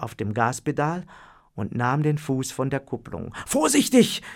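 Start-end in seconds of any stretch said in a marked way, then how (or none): 3.5-4.2 high, loud cry of alarm; warning shout. Acted. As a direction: yell a warning. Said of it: "rief Lothar".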